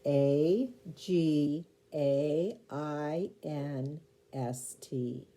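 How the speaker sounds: slow letter-by-letter spelling. Shown as a demonstration. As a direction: spell out each letter slowly and clearly. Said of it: "see if you spelled it correctly".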